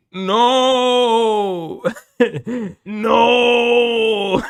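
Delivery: high, long-drawn wailing "no"; laughing mock Arnold cry, stretched out; Arnold impression. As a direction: wail it like Arnold, half laughing. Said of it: "He sounded like Arnold".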